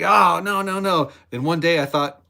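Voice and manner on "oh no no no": mock modest denial. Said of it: "And I was like".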